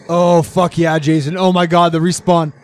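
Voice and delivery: loud, thrilled hype for a teammate; excited cheering. Real, not acted. Player celebrating teammate's lucky respawn, loud and excited. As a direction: cheer the play at full voice.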